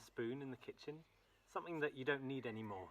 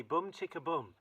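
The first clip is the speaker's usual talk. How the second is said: louder call; 3 semitones higher, 5 dB louder than usual. Shown as a demonstration.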